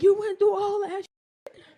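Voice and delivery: high, loud retelling of his disbelief; incredulous partner. Retold or imitated. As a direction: imitate him, loud and taken aback. Partner reacts to her story.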